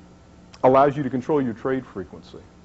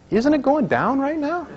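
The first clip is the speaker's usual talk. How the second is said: put-on much higher, slow, pleading child's question; insistent little girl; 14 semitones higher, 4 dB louder than usual. Daughter pressing her dad again, in a much higher, slower child's voice. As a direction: drag it out like a small child who won't let it go.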